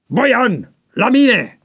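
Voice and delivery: very high, sharp command; shouted summons. A narrator's reading. Chief summoning Boian out of the line, shouting. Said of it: "șeful strigă".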